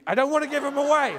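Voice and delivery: fast, high, loud refusal; whining protest. Acted. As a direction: protest clutching your treasured cigars. Queen refuses to part with cigars.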